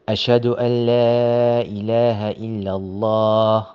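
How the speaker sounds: a recited, chanted "أشهد أن لا إله إلا الله"; adhan recitation. Shown as a demonstration. Teacher recites the adhan line for students. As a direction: chant it like the call to prayer.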